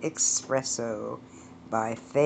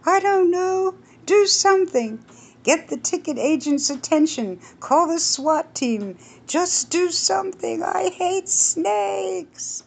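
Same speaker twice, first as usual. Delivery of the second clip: put-on high, loud, panicky older woman; shrill-free high fearful wife voice; alarmed Edith; 14 semitones higher, 3 dB louder than usual. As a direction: gasp it to her husband in alarm. Edith panics about snakes.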